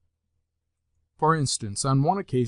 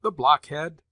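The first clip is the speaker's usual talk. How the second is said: louder, contemptuous outburst; scornful exclamation; 3 semitones higher, 7 dB louder than usual. A narrator's reading. Grandmother insulting the landlord, loud and scornful. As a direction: spit out the insult. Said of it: "exclaimed the grandmother".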